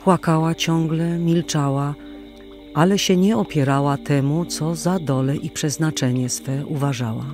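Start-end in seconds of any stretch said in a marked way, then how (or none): none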